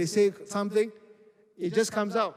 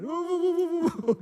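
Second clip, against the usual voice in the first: imitating a friend's hooting; 11 semitones higher than usual. Imitating Clarence rubbing people's heads, high playful hooting. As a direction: hoot like an excited big brother rubbing your head. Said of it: "he grabbed me by the head".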